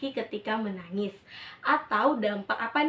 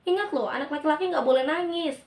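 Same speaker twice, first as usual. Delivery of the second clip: put-on higher, louder warning to a crying boy; a stern parent laying down the rule; scolding parent; 6 semitones higher, 4 dB louder than usual.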